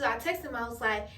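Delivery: ordinary delivery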